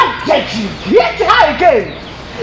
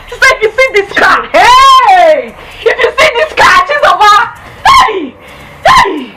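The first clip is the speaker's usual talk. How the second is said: raised, gushing exclamations; excited raving; 3 semitones higher, slower than usual. Acted. Telling sister about the car, wildly excited. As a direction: rave as if you can't believe it.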